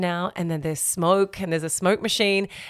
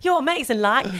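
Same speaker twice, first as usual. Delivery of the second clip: imitating Abigail's accent; 6 semitones higher than usual. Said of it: "I was gonna do her accent".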